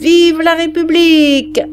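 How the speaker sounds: high loud cheer; the grenadiers cheer the adoption; shout. Acted. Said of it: "crièrent les grenadiers". (acclamation des grenadiers)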